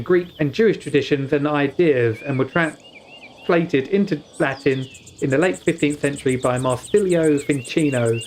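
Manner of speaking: ordinary talk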